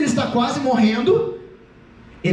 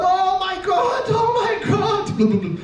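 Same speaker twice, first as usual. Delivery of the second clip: high, drawn-out mock wail; slow, melodramatic cry of alarm; panicking Peter; 8 semitones higher, much slower than usual. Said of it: "ele deve ter começado".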